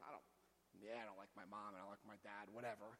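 low, soft, bored recital; mock dismissive mumbling. Alcoholic skimming over his parent resentments, mock dismissive. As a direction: toss it off as if it hardly matters. Mimicking dull, petty inventory items.

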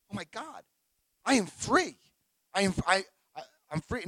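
high, breaking-in amazement; awed realisation. Retold or imitated. Just out of jail, realising it, awed. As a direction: let it dawn on you, pitch lifted.

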